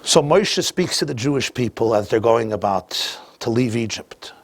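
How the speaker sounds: usual speaking voice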